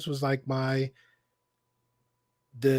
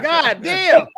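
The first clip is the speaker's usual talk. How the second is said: high, loud "God damn"; retold dismayed shout; 12 semitones higher, 9 dB louder than usual. Storyteller relives the moment the bus turned on him, loud dismay. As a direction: blurt it out, shocked and loud. Realizing bus wants to fight.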